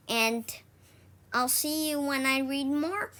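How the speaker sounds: ordinary delivery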